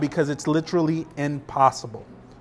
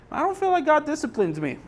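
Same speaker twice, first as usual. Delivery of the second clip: lightly voiced doubter, higher and softer; a hint of a doubting churchgoer, higher and softer; doubting listener; 9 semitones higher, 5 dB quieter, faster than usual. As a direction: mimic a listener's quiet doubt. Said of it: "you might be asking yourself".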